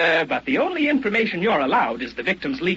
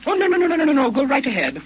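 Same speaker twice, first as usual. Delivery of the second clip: high, loud flurry of no's; quick eager denials, pitched up; hasty reassurance; 9 semitones higher, 4 dB louder, faster than usual. Acted. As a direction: rush to reassure him.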